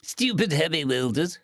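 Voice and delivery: put-on a childish, sulky whine from a grounded bird-kid; whining. A narrator's reading. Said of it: "Stowaway whined again".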